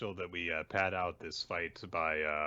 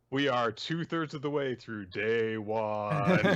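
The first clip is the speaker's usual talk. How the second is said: mock dramatic announcement; 3 semitones higher, 6 dB louder, slower than usual. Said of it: "jokes about the slow chapter".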